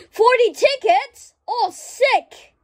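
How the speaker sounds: shocked at the price; surprised gasp. Real, not acted.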